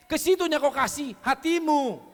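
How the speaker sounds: loud, slow, hammering each word home; preaching at full force. Real, not acted. Preacher pressing the congregation, loud and slow.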